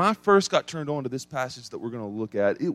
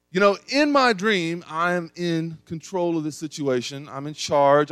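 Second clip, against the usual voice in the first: normal talking voice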